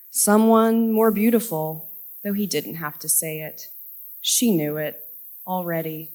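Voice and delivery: slow, measured poem recital with deliberate pauses; poetry reading. Acted. Poet reading Alva's desert breakup to a festival audience, measured recital. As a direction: read it line by line, leaning on key words.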